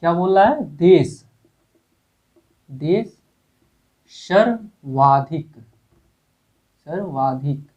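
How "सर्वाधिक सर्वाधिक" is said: सर्वाधिक stretched very slowly, syllable by syllable; teacher dragging out सर्वाधिक for the class; slow dictation. Shown as a demonstration. Teacher dictating to students, very slow सर्वाधिक. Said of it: "बिल्कुल ध्यान से देखिएगा".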